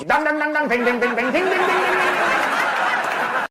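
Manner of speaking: high sing-song slot machine jingle; casino sounds. Acted. Mocking accent as casino machine noises, high jingle.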